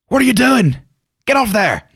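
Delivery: high-pitched Irish dwarf squawk; Irish dwarf accent. Retold or imitated. Player re-enacting his dwarf's first appearance, high Irish accent. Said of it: "Do I do Cockney or do I do Irish?".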